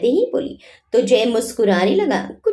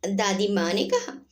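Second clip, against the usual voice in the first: ordinary delivery, nothing marked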